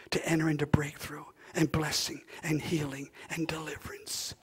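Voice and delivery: hushed, breathy whisper of promise. Real, not acted. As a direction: lean in and whisper it to the church. Pastor drops voice for emphasis.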